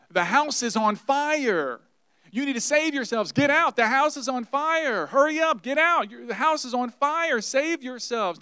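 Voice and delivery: high, loud alarm cry; man running through a burning house warning people to get out; urgent warning shout. Pretend. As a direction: yell it like the house is burning behind you. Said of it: "a man running around, around the house telling people".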